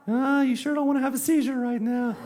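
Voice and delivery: high sneering cartoon pest warning of a seizure; taunting inner voice. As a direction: play the mocking cartoon gremlin in his head. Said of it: "like, that voice that's like".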